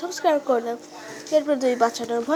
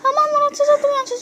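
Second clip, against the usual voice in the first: put-on much higher, louder, squeaky girl voice accusing; high girlish voice; 12 semitones higher, 4 dB louder than usual. Samira accuses Raj of taking her flowers, high squeaky girl voice. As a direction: play the little sister catching a thief.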